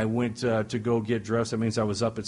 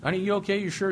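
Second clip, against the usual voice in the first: much higher, pleading "honey, you okay?"; insistent; 6 semitones higher, faster than usual. Retold or imitated. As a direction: press her, anxious and insistent. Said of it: "now I'm getting a little insistent".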